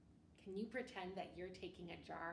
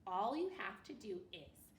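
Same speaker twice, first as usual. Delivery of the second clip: plain reading voice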